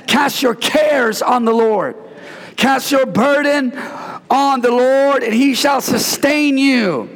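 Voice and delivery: a pastor pressing the verse on the church; full-force preaching. Acted. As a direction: preach it out, stretching every phrase.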